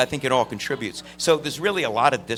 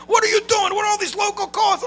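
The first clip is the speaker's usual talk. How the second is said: much higher, loud hammed-up shout; father blowing up; 5 dB louder than usual. Father yelling at son about the phone bill, hammed-up shout. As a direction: explode like an outraged dad. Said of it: "He just blew up at me".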